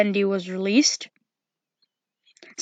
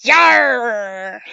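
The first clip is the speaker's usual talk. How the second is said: a hammed-up pirate growl; pirate Foxy. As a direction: bark it like a cartoon pirate fox. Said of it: "what I imagine Foxy sounds like".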